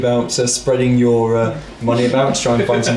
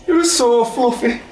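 high NPC voice; 9 semitones higher than usual. Acted.

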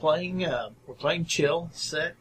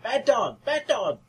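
scolding shout; 12 semitones higher than usual. Acted. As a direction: call the dog back angrily, shouting. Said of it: "She's like".

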